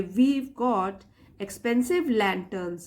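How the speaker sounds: "We've" joined into one syllable; contracted form. Shown as a demonstration. Teacher modelling how to read the line aloud, "We've" joined. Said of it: "पढ़ते वक्त भी ऐसे बोलेंगे".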